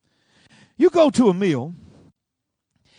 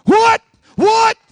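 high, loud cry of disbelief; hammed-up shocked shout; astonished outcry; 14 dB louder than usual. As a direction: exclaim like a stunned crowd. Acts the crowd's astonishment.

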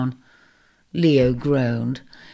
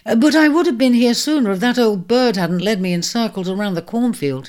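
defensive; 7 semitones higher, 4 dB louder than usual. Acted. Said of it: "he said defensively".

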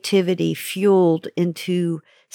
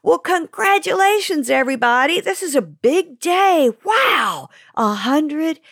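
excited; 7 semitones higher than usual. Acted. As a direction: cheer it, drawing out the words. Celebrating the hundredth episode.

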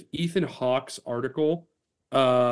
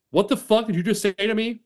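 higher, angry acted challenge; growled challenge; 8 semitones higher, 4 dB louder than usual. Host reading a story, voicing Chris furious at the Star, angry growl. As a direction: snap it back like a man provoked. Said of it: "Chris growled".

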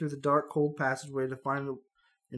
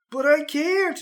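cry out; 12 semitones higher, 7 dB louder, slower than usual. Acted. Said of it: "you cry".

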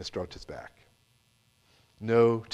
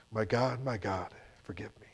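fading to a hushed plea; tearful prayer; 2 semitones lower, 9 dB quieter than usual. Retold or imitated. Mother praying in the car after the encounter, fading to a hush. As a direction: whisper it out as the tears come.

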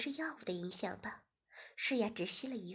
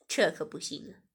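lower, whispered refusal; teasing male voice; 4 semitones lower, 6 dB louder than usual. Acted. Host refusing Shiya, lower and whispered. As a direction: refuse low with a chuckle.

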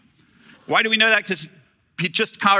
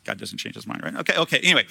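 usual speaking voice

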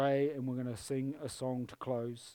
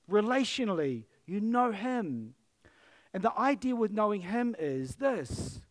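preaching at full force; 4 semitones higher, 6 dB louder than usual. Acted. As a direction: drive it home to the congregation. Pastor preaching with rising force.

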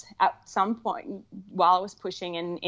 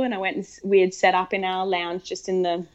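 usual speaking voice

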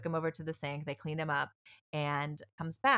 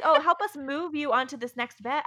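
mock nurse request; 8 semitones higher, 6 dB louder than usual.

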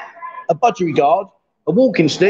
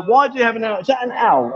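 ordinary delivery

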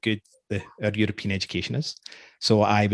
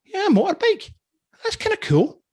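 high, impressed voice. Retold or imitated. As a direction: sound genuinely impressed. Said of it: "I'm thinking".

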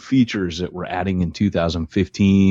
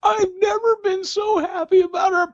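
very high, squealing mock laugh-cry; imitating helpless laughter. Teasing co-host about laughing, very high mock hysterical squeal. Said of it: "You could not stop laughing".

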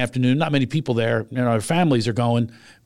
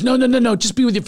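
insisting; 9 semitones higher, 3 dB louder than usual. Retold or imitated. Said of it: "she kept insisting".

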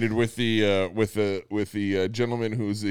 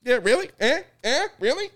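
high, pitched-up mocking questions; ranting comedian impression; 12 semitones higher than usual. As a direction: throw out squawky rising jabs. Mimics comedian's rant.

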